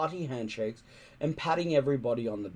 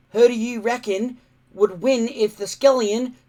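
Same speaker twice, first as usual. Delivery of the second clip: put-on higher, louder eager boy's chatter; keen young Viking boy chattering; 9 semitones higher, 9 dB louder than usual. A narrator's reading. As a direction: rattle it off like an excited kid. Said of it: "chattered Warthog".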